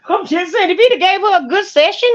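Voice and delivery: chuckling while defending the joke; laughing. Real, not acted. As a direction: laugh it out, then say it through the laugh.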